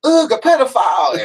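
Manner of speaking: high, slowed disgusted holler; mock heckler yell. Audience member heckles the comic in his drawers, mock yell. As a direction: imitate a heckler crying out in disgust. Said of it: "and then somebody yelled out".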